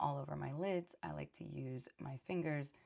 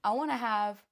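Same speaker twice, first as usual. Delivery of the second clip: playful excited; 6 semitones higher, 10 dB louder than usual. Real, not acted. Joking demand, laughing.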